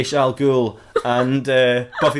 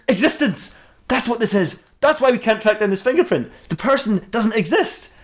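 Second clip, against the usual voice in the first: excited realization; 9 semitones higher than usual. Acted. Batman's sudden realization.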